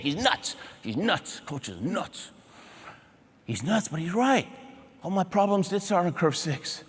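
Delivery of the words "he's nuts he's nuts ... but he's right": imitating private complaint, fading away; muted grumble. Athlete grumbling about coach after radio call, fading soft. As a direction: trail off softly to yourself.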